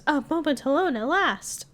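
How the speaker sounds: hammed-up falsetto line; high-pitched voice. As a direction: squeak it out like a lovestruck partner. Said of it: "the high-pitched voice goes".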